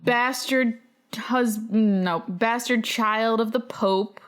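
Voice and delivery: normal talking voice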